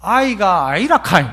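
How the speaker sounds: 경상도 사투리로; Gyeongsang dialect. Shown as a demonstration. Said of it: "이걸 경상도 말로 하면".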